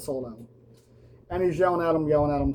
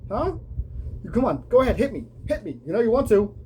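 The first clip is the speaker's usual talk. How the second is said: mocking challenge in a raised voice; taunting Seth; 8 semitones higher than usual. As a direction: goad him, daring him to swing. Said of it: "and Seth's like".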